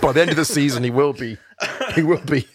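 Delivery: laughing through the words; giggling while talking. Real, not acted. Joking about Pep on Rogan.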